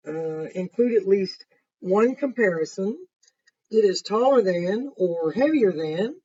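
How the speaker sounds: lively picture-book narration; reading to children. A narrator's reading. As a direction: read it to the class with energy. Reads the assignment sheet aloud.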